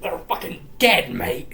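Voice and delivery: hushed, growled put-on anger; mad father. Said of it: "he's very mad".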